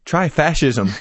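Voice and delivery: usual speaking voice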